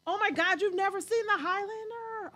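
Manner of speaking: high, loud fan outrage; shocked disbelief. Real, not acted. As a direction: exclaim in utter disbelief. Shocked listener missed a favorite film.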